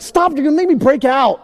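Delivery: high, loud protest; mock annoyed wife. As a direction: imitate a wife fending him off. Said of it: "She's like".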